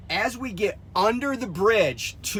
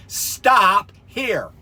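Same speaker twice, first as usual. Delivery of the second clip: re-enacted order, loud and raised in pitch; firm command; 4 semitones higher, 9 dB louder than usual. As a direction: command it like stopping a driver. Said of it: "I said it in that tone of voice".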